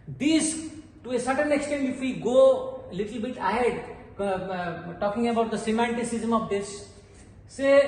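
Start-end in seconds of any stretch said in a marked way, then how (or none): none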